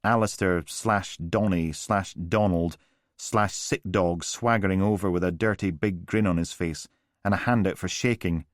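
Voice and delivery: usual speaking voice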